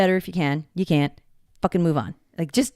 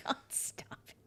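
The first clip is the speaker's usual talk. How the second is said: laughing whisper; 20 dB quieter than usual. Real, not acted.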